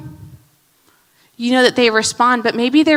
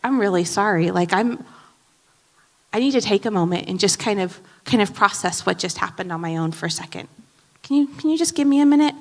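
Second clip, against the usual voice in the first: gentle apology; 3 semitones lower, 8 dB quieter than usual. Pretend. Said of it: "You may even be able to say".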